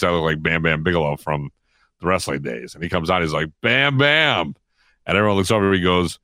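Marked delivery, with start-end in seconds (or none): 3.6-4.5 higher, drawn-out nickname call. Retold or imitated. Player greets reporter coming out of the shower, calling out. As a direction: imitate a player hailing his buddy.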